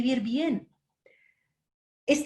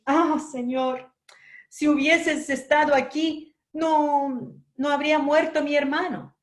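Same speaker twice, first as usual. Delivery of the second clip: crying plea; 5 semitones higher than usual. A narrator's reading. Mary grieving to Jesus over her dead brother, tearful plea. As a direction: plead through tears, mildly acted. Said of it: "le dijo llorando".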